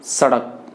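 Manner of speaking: careful teacher's सड़क, crisp dental s, falling end; dental S demo. Shown as a demonstration. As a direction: say सड़क with the first sound स made at the teeth. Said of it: "इसका जो उच्चारण होगा". (सड़क में दंत्य स का उच्चारण)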